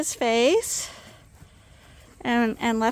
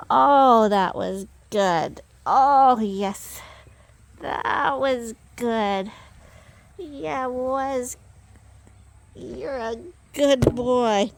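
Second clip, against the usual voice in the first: cooing; 4 dB louder, much slower than usual. Real, not acted. Cooing at sheep after hug.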